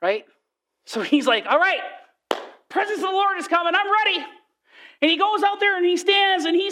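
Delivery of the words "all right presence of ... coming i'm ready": high, keyed-up readiness; eager anticipation. Acted. Preacher acts out Elijah awaiting the Lord, eager. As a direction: pitch it up, raring to go.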